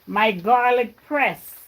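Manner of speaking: ordinary talk, nothing marked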